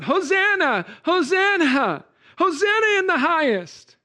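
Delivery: high, loud, jubilant Hosanna; joyful crowd cry. Retold or imitated. Multitudes hailing Jesus, high, loud and jubilant. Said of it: "the multitudes were crying out".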